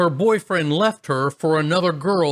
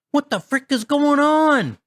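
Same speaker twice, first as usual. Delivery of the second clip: much higher, frightened cry; panicked sister; 12 semitones higher than usual. Retold or imitated. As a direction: cry out in alarm. Scared sister hears ghost voice.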